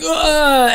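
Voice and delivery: high, strained mock groan; imitated groan. Fan retelling the Venom trailer to friends, imitating a groan. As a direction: fake the hurt groan from the trailer. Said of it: "and going".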